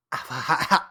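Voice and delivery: ditzy drawn-out mock girlfriend voice. How his girlfriend talks in his head, ditzy mock voice. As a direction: say it like an airhead. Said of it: "That's how she talks in my head".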